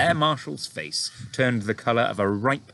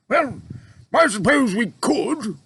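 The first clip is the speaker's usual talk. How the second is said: grudgingly; 7 dB louder, slower than usual. A narrator's reading. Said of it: "admitted grudgingly".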